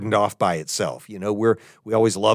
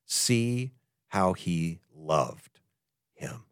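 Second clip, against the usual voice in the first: very slow and soft, word by word; hushed, tender quote; 5 semitones lower, 9 dB quieter, much slower than usual. Retold or imitated. Preacher quoting the onlookers at Jesus weeping, slow and hushed. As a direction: let each word sink in, dropping away quietly.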